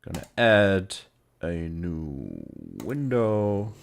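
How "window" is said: ordinary talk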